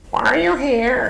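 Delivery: put-on high, sing-song North Dakota accent; Minnesota accent. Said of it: "in my North Dakota, Minnesota voice".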